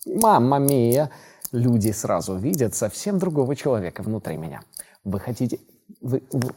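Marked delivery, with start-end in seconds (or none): 0.1-1.1 loud, drawn-out Italian mamma mia; slow Italian exclamation. Pretend. 5.0-6.5 lightly voiced TV shopping pitch; infomercial. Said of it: "телемагазин".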